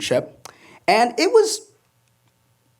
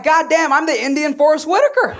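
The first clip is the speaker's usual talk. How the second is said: high, loud mock delight; laughing self-mock exclaim; excited exclaim; 9 semitones higher, 5 dB louder than usual. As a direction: blurt it out in mock awe. Stand-up punchline, excited quote.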